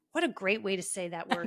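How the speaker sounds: usual speaking voice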